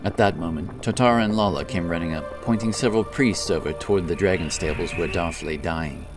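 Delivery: usual speaking voice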